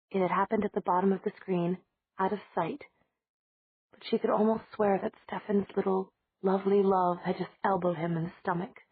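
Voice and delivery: ordinary delivery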